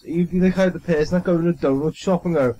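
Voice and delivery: usual speaking voice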